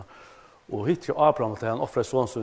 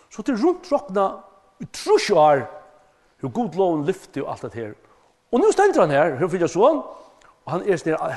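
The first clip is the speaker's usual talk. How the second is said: loud, higher pulpit voice; full-force preaching; 6 semitones higher, 8 dB louder than usual. Acted. Preacher tells the congregation about Abraham's long wait, loud and fervent. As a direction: drive it home to the hall.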